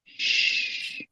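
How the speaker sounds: breathy unvoiced shush; ghostly shush. Ghost girl silencing the frightened guest, whispered shush. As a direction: hush her like a ghost.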